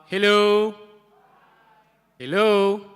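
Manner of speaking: high and loud calling greeting; loud hello to the crowd. Real, not acted. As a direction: shout it out to the room.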